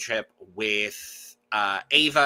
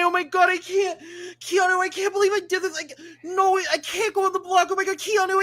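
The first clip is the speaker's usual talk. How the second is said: way higher, whining and pleading; whiny Vince parody; faster than usual. Retold or imitated. Mocking Vince's groveling speech to his ally, whiny and high. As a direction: squeal like a spineless man begging.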